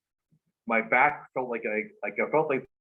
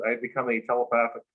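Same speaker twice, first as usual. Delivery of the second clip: normal talking voice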